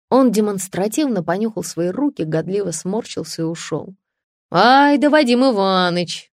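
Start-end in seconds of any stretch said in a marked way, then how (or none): 4.5-6.3 loud, drawn-out cheer from the crowd; hearty, raised bravo; admiring exclamation. A narrator's reading. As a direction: hail him with delighted approval. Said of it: "воскликнул кто-то".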